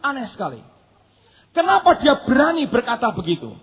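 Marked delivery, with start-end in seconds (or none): none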